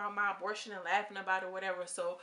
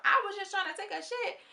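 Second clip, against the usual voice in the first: mock Jocelyn; 10 semitones higher than usual. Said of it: "Jocelyn comes back with her shoes off".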